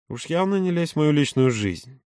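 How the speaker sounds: ordinary delivery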